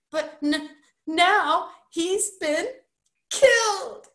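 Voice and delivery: high, slow, halting wail rising to a loud last word; sobbed. A narrator's reading. Roderick telling Bert of his father's death, sobbing. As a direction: stumble through it in tears. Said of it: "sobbed Roderick".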